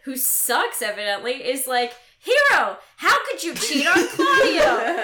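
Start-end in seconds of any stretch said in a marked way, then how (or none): 2.2-5.0 high, loud, hammed-up reproach; mock scolding. Dad scolding his accused daughter at her wedding, mock appalled. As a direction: play an appalled parent.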